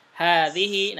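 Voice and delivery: Hadhihi with the long vowel drawn out; Hadhihi, stretch the long syllable. Shown as a demonstration. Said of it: "setiap hadhihi haknya panjang".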